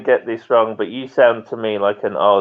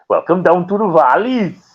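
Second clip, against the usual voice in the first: put-on playful Welsh accent, pitched higher; Welsh twang; 7 semitones higher than usual. Host shows off a Welsh twang to the caller, playful Welsh accent. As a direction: sing it out like a friendly Welsh valley host. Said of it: "I mean Welsh twang".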